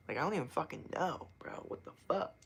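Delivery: whispered disbelief; baffled whisper. Real, not acted. Streamer puzzling over dirty fingernails, baffled whisper.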